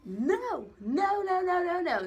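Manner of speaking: loud, high-pitched string of noes; emphatic exclamation. Real, not acted. Exclaiming over the ex she misses, loud emphatic noes.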